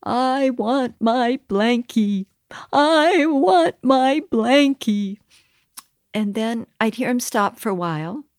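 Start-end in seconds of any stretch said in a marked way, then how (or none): 0.0-2.3 put-on slow, whimpering little-boy plea; crying toddler. Mother retelling her son whimpering for his blankie, slow childlike whine. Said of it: "one day crying in the corner". 2.7-5.2 put-on louder, higher, slow wailing child; crying toddler louder. Mother retelling her son wailing louder for his blankie, higher childlike cry. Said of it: "one day crying in the corner".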